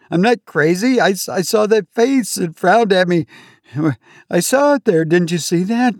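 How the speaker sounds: desperate to be believed, voice climbing; frantic insisting. Acted. Patient begging the attendant to believe him, frantic and high.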